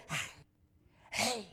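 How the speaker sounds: "Hey" breathed out from the back of the throat, airy h; Hebrew throat hey. Shown as a demonstration. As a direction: push air from the throat on "Hey". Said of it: "let the air in the back of their throat come out".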